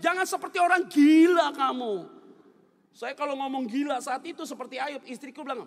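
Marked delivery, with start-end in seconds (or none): 0.0-2.1 loud, high mock scolding; imitated rebuke. Husband rebuking wife as Job did, loud mock scolding. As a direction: scold loudly as if quoting Job. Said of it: "saya nggak bilang seperti Ayub".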